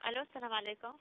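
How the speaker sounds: thin phone line voice; over the phone. Real, not acted. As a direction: heard through a phone speaker. Doctor's voice heard via phone.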